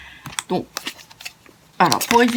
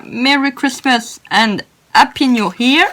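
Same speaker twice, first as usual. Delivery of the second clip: slow, drawn-out English in a French accent; 2 semitones higher, 4 dB louder, slower than usual. Real, not acted. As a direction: announce the greeting slowly, French-accented. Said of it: "excusez-moi pour mon anglais". (anglais lent à l'accent français)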